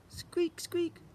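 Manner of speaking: high squeaky animal noise; mock squeak. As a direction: squeak like a mouse.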